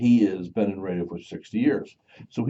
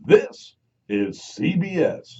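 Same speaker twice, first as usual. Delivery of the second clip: slow, stretched announcer ident; lightly voiced CBS announcer; network announcer; 6 semitones higher, much slower than usual. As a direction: draw out each letter. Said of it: "the guy who said".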